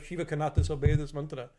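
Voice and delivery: usual speaking voice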